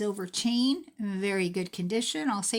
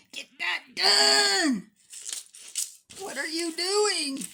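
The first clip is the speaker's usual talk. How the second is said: much higher, louder mock shout at the screen; imitated yelling viewer; 10 semitones higher, 7 dB louder, slower than usual. Viewers yelling at the screen over the tangles, mock shout. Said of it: "yelling at your thing".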